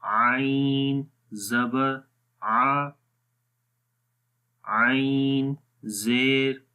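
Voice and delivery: slow spelled recitation, आइन ज़बर आ drawn out; Quranic letter drills. Shown as a demonstration. Tajweed teacher drilling the vowels of ain, slow recitation. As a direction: recite each vowel of the letter slowly and clearly. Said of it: "अब इसकी मिसालें पढ़ते हैं".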